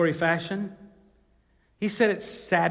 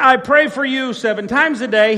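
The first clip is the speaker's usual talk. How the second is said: louder, higher hypothetical line; preacher voicing what Paul never said, loudly; preached mock quote; 7 semitones higher, 11 dB louder than usual. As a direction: boom it from the pulpit.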